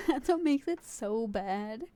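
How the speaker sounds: slow, crying with laughter; laughing to tears. Real, not acted. Streamer helpless with laughter at a meme. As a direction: wheeze through the laughter.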